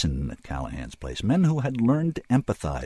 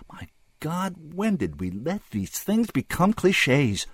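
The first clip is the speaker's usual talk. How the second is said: slow, higher-pitched aghast question; dismayed realization; 7 semitones higher, slower than usual. A narrator's reading. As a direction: ask it as a stunned inner cry. Narrator voices the men's dismayed thought.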